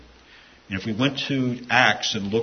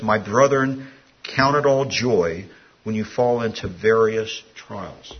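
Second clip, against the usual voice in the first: scripture reading; 6 dB louder, slower than usual. Acted. Reads scripture aloud.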